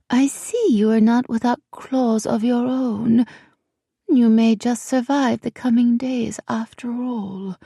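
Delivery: high, silky royal voice fading to a whisper; a queen amused and menacing. Acted. Queen speaks to Ellie.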